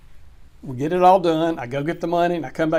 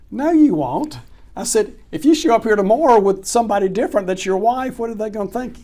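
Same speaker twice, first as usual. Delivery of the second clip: louder and higher, retelling a blunt rebuke; loud, higher mock scolding of the seller; retold firm rebuke; 5 semitones higher, 5 dB louder than usual. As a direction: tell him off again, raised and sharp.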